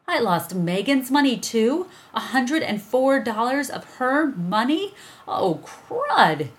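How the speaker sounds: high, loud, anxious disbelief; rising panic over the lost money; panicked dismay. A narrator's reading. Evan realizing he lost his sister's friend's money, panicked.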